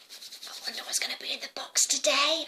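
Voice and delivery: whispered eager curiosity; excited whisper. Acted. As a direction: whisper like a child with a secret. Girl wonders about the box.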